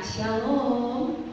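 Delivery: a syrupy, overly sweet Shalom; sugary sweet greeting. Retold or imitated. Churchgoer greeting at church, mock sugary sweet. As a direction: greet like a hypocrite all sugar. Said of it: "wah, manis banget tuh".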